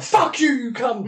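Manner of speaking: high-pitched snapped insult; mock angry retort. Boxer snapping at his opponent after the fight, high mock anger. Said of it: "I'm like".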